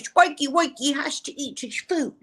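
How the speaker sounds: high, playful cooing; cutesy baby talk. Acted. Drusilla fussing over Spike's meal, cutesy baby talk. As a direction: coo it like talking to a pet.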